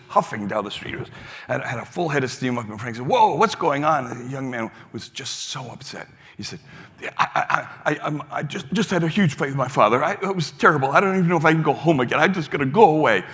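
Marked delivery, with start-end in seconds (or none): 3.1-4.1 startled call. Retold or imitated. Frank stops upset young man. 7.0-13.2 loud, higher, rushed and stammering; upset outburst. Retold or imitated. Retelling the upset young man, loud and stammering.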